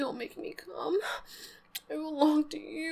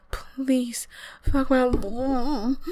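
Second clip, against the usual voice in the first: louder, slow, breaking plea; crying plea; 8 dB louder, much slower than usual. Acted. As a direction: drag it out, almost sobbing. Repeated plea, intensified take.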